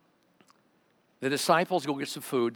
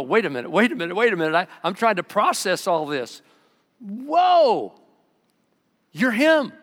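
stammering as it sinks in, then blown away; building amazement, ending in a loud high whoa; excited disbelief; 6 semitones higher, 7 dB louder than usual. Acted. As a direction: voice climbing higher and louder into an astonished whoa. Woman realising Jesus is Messiah.

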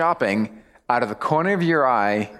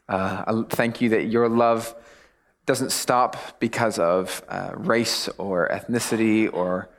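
prayer voice; 4 semitones lower than usual. Acted. Opening prayer.

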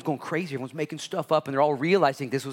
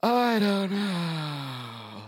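very slow, higher put-on guilty voice; sheepish mumble; 7 semitones higher, much slower than usual. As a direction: drag it out like a caught kid. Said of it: "You're like".

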